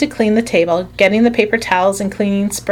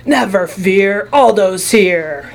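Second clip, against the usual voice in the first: slow, louder hammed-up announcement; roared; 3 dB louder, slower than usual. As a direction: bellow it like a hero arriving.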